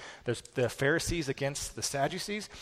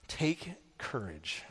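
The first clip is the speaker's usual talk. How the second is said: tender; 2 semitones lower, 6 dB quieter, much slower than usual. Acted. Jesus' words, read slowly.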